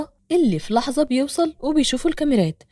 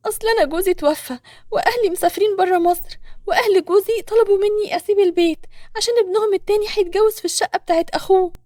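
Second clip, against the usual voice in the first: put-on high young woman's voice; distressed young woman; 7 semitones higher than usual. A narrator's reading. Nour explains losing husband and home, high voice. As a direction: pitch it high, pleading out the story.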